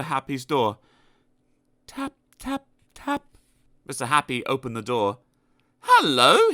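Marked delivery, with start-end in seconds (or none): 1.9-3.2 soft tapping. Acted. Quiet knock. 5.8-6.5 cheerful hello. Acted. Mister Happy greets.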